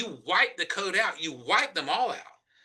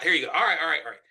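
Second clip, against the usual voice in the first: normal talking voice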